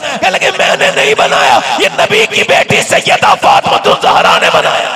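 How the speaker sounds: high, loud fervent preaching voice; full-force preaching. Acted. (पूरे जोश में तक़रीर)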